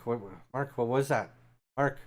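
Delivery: ordinary talk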